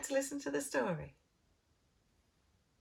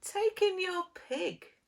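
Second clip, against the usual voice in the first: put-on higher, slow old man's advice; wise old man; 8 semitones higher, slower than usual. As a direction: suggest it slowly and knowingly. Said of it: "said the wise old man".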